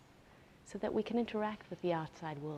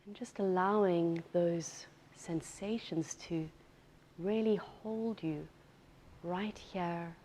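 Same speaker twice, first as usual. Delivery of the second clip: guided meditation. Real, not acted. Yoga teacher settling students into breath awareness, unhurried guided meditation. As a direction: ease them into stillness, leaving space between phrases.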